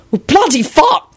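high, startled exclamation; startled curse. A narrator's reading. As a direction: swear as you jump back. Startled by sudden arrivals.

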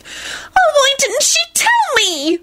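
very high, plaintive wail; hammed-up high-pitched lament. A narrator's reading. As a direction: wail it in distress. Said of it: "Solange wailed".

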